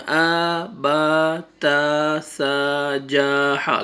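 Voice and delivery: آ، با، تا، سا، جا، حا each stretched long and run on; wrongly dragged out. Shown as a demonstration. Teacher shows students what to avoid, overlong vowels in آ، با، تا، سا، جا، حا. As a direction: drag every vowel in آ، با، تا، سا، جا، حا. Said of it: "کھینچنا بھی نہیں ہے".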